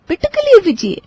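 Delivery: put-on baby talk. Mother coos to baby son.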